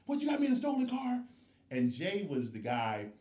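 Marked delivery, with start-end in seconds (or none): 0.1-1.2 high, loud and fast, alarmed; hammed-up shocked friend; panicked disbelief. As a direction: yelp it like your friend just woke up to trouble. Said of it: "He's like".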